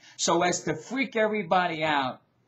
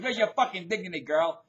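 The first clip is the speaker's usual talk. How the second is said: normal talking voice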